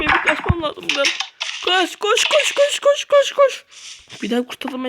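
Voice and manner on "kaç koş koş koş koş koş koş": panicked running. Acted. Fleeing monsters in panic.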